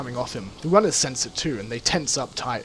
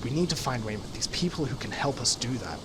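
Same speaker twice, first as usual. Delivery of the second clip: softer, low-key aside; speaking quietly; 3 semitones lower, 7 dB quieter than usual. Acted. Carter murmuring to Wu mid-standoff, quiet and softer. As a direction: keep it down, just for Wu to hear. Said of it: "She speaks quietly to Wu".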